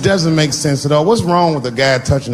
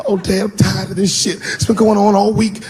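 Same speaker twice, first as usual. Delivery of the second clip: put-on whiny kid; 7 semitones higher than usual. Son complaining to dad.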